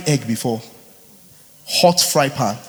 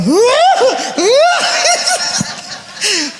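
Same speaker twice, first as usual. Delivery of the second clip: high laughter; 4 dB louder than usual. Real, not acted. Mocking okra remedy.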